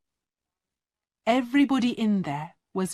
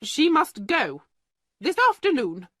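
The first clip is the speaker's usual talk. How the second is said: angry; 6 semitones higher than usual. Acted. Headmistress ordering the penniless girl out, angry and high. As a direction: throw her out in a rage. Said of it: "Miss Minchin said angrily".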